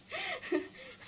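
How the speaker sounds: short high, soft giggle. Real, not acted. Amused by her own praise of the teacher, giggling. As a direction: break into a quiet high-pitched laugh.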